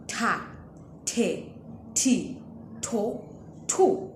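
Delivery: "ta, te, ti, to, tu" with a breathy aspirated th, a puff of air on each; aspirated T syllables. Shown as a demonstration. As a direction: push air after each t. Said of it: "when you add an H, it then becomes".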